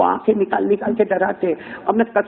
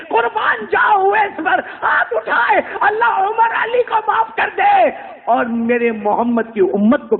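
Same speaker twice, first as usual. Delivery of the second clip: impassioned cry; 12 semitones higher than usual. Acted. Preacher's emotional peak.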